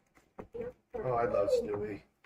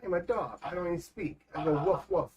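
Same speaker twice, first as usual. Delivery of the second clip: stubborn dog voice; 5 semitones higher, 3 dB quieter than usual. Retold or imitated. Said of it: "He's like".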